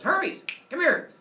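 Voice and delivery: loud, high summoning yell; bossy god's summons; calling out. Acted. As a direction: holler for someone across the palace. Zeus summons Hermes.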